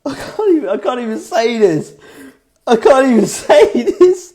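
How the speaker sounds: high-pitched words squeezed out through laughter; can't get the line out for laughing; real giggle fit, pleading with listeners; helpless laughing fit. Real, not acted. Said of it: "why am I laughing?".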